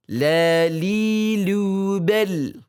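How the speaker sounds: "La, li, lu, bel" with a light, thin l, never heavy; light lam chant for students; thin lam drill. Shown as a demonstration. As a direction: keep every "la" thin and clear. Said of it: "Harus tipis".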